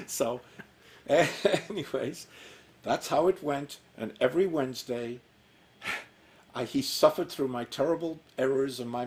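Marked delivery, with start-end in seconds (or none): none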